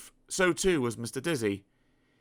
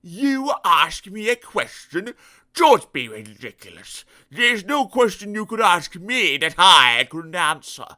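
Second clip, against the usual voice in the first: loud, slow scoffing; rude, scornful pig; 6 semitones higher, 9 dB louder, slower than usual. Acted. Pig scorning Mister Dizzy's question, rude. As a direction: look down your snout at him. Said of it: "grunted the pig rudely".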